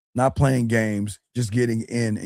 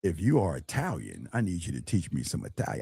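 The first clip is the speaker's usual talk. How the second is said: much softer, lower, fast mock of a timid guy; mocking imitation; 7 semitones lower, 10 dB quieter than usual. Coach mocking a timid student's pickup line, soft and low. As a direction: mimic a meek guy asking to learn Italian.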